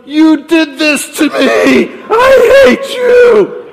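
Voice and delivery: drawn-out high scream of blame, very loud; woman yelling in labor. Retold or imitated. Woman down the hospital hall blaming her partner, yelled. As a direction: scream it slowly, full force, imitating a laboring woman. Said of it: "We heard the woman yelling".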